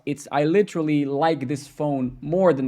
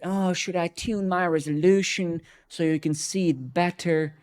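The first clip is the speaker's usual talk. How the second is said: higher, overly sweet sarcastic offer; sugary fake helpfulness; mock helpful; 5 semitones higher than usual. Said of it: "I was like".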